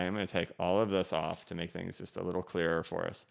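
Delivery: ordinary talk, nothing marked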